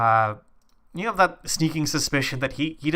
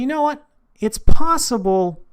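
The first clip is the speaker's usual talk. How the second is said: excited; 12 semitones higher, 10 dB louder than usual. Acted. NPC exclaims an idea.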